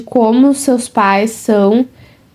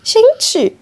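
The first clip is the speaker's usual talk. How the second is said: high exclaim; 10 semitones higher than usual. Real, not acted. Reacting to photo, hiccuping.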